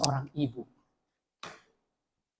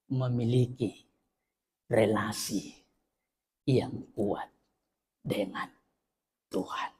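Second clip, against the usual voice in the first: fading quiet; 6 semitones lower, 7 dB quieter, slower than usual. Real, not acted.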